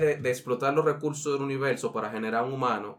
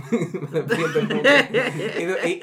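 laughing; 6 semitones higher, 6 dB louder, much slower than usual. Real, not acted. Laughing while telling a scary story.

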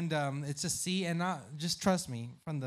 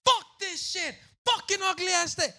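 angry yelling; 14 semitones higher, 7 dB louder than usual. Retold or imitated. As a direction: rage at the bad call. Yelling at refs in stadium.

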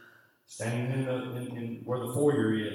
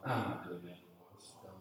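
sulky thought; 6 semitones lower, 13 dB quieter, faster than usual. Retold or imitated. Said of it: "And I thought".

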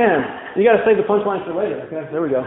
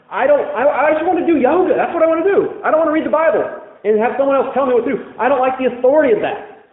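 mock spiritual seeker; 6 semitones higher than usual. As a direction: parody someone who only wants yoga. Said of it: "people who say".

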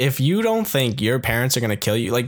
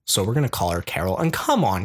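usual speaking voice

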